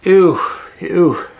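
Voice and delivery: high, loud disgusted ew. Acted. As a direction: recoil from the smell. Reacting to rotten egg smell.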